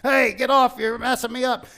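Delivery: loud, high annoyed shout; angry protest. Retold or imitated. Balaam with his foot pinned, shouting angrily. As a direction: shove him off in pain and anger.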